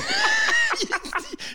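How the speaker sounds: real squeal of laughter; high shrieking laugh. Real, not acted. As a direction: burst out in a high cackle. Big laugh.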